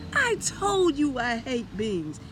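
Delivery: high, loud, slow angry squawk; angry Donald. A narrator's reading. Donald scolds Mickey over the beans, high, loud and angry.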